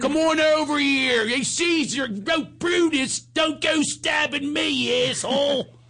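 high, slow mock Philadelphia yell; hammed-up Philadelphia street voice; Philly accent. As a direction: shout like a Philly guy getting stabbed. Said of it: "a Philadelphian going".

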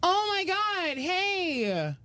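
high, squealed mock greeting; gushing girl fan. Girl spots him in the grocery store, imitated gushing. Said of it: "this girl sees me ... she goes".

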